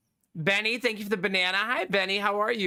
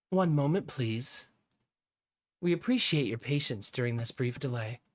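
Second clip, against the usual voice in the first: lower, lightly voiced automated announcer; mock hold message; 5 semitones lower than usual. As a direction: play a polite hold recording, pitched down. Mock hold message during a delay.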